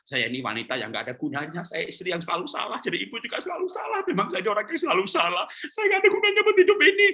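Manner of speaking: mock wail of a woman who always blames herself; self-pitying wife. Preacher mocks a self-pitying mother, high fast whine. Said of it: "Jangan berkata".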